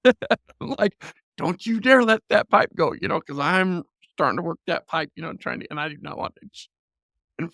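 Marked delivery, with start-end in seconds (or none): 1.4-2.9 raised, higher stern warning. Retold or imitated. Dad warning his daughter not to let go of the pipe, loud stern warning. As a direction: warn her hard not to drop it.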